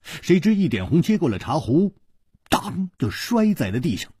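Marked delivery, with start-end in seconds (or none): none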